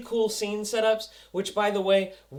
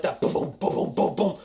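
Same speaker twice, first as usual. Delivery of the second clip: ordinary talk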